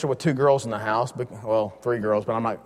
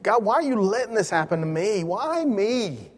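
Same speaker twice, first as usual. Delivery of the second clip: higher, pleading mock whine; whining to God; 8 semitones higher than usual. Believer asking God why me, mock whine. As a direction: plead like a put-upon child. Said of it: "but you".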